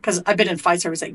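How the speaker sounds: ordinary delivery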